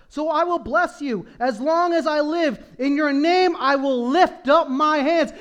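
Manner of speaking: shouted worship declaration; fervent proclamation. Real, not acted. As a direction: lift it up in praise. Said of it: "reads then preaches psalm".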